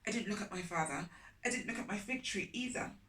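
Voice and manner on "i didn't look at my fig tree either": plain reading voice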